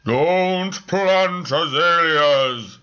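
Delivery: loud, slow booming spectral cry; booming ghost. Acted. Spirit answering the medium, slow booming cry. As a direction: boom the word slowly from beyond.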